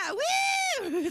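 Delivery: very high, loud "woo"; mock party whoop. Friends cheering morning vodka.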